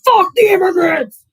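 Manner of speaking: hammed-up angry rally shout; mock maga shout. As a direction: bellow it as a parody bigot. Impersonating a maga voter.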